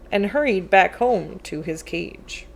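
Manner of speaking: ordinary delivery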